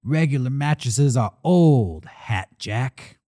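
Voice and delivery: low, slow, stilted line reading; salesman's botched line. Retold or imitated. Mocking the actor's wooden delivery, low and slow. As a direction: read it wooden, the way the bad actor did. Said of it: "But he goes".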